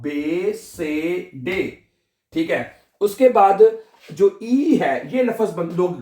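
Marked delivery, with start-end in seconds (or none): 0.0-1.6 आ बे से डे, very slow, each letter drawn out; slow alphabet recitation. Shown as a demonstration. Teacher recaps German letters for beginners, slow drawn-out recitation. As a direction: recite आ बे से डे one long vowel at a time. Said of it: "डी को डे पढ़ते हैं".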